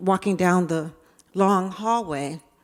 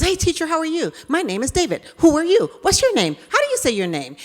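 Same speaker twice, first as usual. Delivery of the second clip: put-on higher, bright-eyed little boy greeting; eager child voice; 9 semitones higher, 4 dB louder, faster than usual. David greeting his new teacher, eager and higher. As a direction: chirp it like an excited kid meeting his teacher. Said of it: "he goes".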